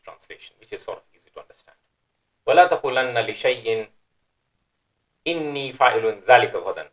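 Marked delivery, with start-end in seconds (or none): 2.5-6.9 verse recited in a measured chant; تلاوة مجودة; Quran recitation. Shown as a demonstration. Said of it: "I'll read it once in the Arabic text".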